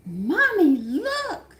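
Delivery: put-on high, bright child's call of "Mommy, look"; little boy calling mommy. Son calling his mother to see the floor, in a high child's voice. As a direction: pipe up like a proud little kid.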